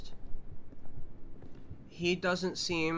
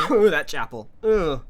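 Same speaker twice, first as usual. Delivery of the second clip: disgusted groan; 3 semitones higher, 11 dB louder than usual. Real, not acted. Disgust after scary chapel.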